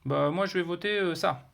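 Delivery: high-pitched put-on voice, drawn-out dithering; hesitant Gertrude. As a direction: play an undecided player in a high mock voice. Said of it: "Je suis Gertrude". (voix aiguë d'une joueuse qui hésite)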